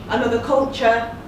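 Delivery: ordinary delivery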